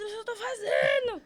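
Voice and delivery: high, strained, tearful shout; tearful yell mid-push; exasperated cry. Retold or imitated. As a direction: snap back in desperation. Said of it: "Aí eu falei".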